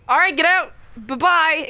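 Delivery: impatient imitation of a dismissive woman; brusque send-off. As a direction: shoo away an unwanted suitor, lightly acted. Woman brusquely dismisses her suitor.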